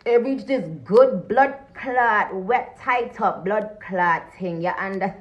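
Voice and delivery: slow, put-on Jamaican patois; drawn-out fake Jamaican twang; fake Jamaican accent. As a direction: lay on a mock Jamaican accent. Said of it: "that fake ass accent".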